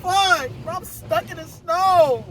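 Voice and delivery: high, slow drawn-out wail; despairing wail. Real, not acted. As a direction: moan it out in despair. Car stuck in snow.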